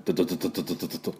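rapid-fire drill stutter; quick repeated "do" like a drill; construction drill noise. Shown as a demonstration. Teacher imitating a construction drill for students, rapid stutter. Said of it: "工事現場ね、ドリルの穴".

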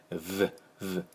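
"ブ、ブ" as soft buzzed V, lip on teeth; brief soft V buzzes for "ブ、ブ". Shown as a demonstration. Teacher showing lip on front teeth, "ブ、ブ" as buzzed V. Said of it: "前の歯に唇を当てて".

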